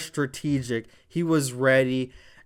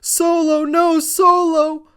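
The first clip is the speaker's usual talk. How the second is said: high, loud, drawn-out pleading scream; mock desperate shriek; imitating a scream; 8 dB louder, slower than usual. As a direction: screech his name like a panicked manager. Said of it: "Heyman screaming".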